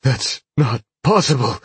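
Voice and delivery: a touch of stunned protest; disbelief. A narrator's reading. Man told his silver pellet is gone, disbelieving.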